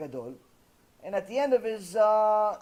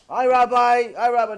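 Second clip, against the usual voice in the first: loud, raised mock greeting; calling a greeting; 4 semitones higher, 9 dB louder than usual. Kindergarten children greet the man they think teaches, loud greeting. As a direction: call out like eager pupils.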